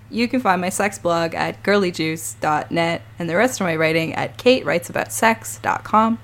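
normal talking voice

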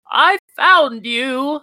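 high, slow, delighted I found you; gleeful old man. Acted. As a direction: pounce on the child with glee. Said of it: "he's like".

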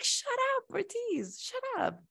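very high, soft mock squeal; tiny high-pitched voice; exasperated squeal. Acted exasperated reply to him.

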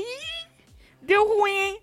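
high drawn-out "uh-oh" squeal; comic high-pitched dread; mock alarm. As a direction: react in a high, teasing put-on voice. Mock reaction to retold scene.

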